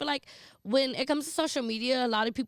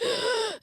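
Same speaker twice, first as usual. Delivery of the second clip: scared gasp; 11 semitones higher than usual. Retold or imitated. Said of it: "scary movies that got me like".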